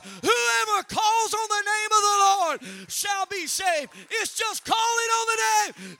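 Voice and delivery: loud, high shouting sermon; full-force preaching. Acted. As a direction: build to a roaring call on the name. Preaching climax, calling congregation.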